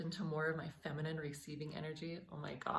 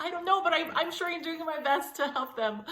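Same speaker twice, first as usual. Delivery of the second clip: laughing reply; 12 semitones higher, 11 dB louder than usual. Retold or imitated. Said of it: "And I'm like".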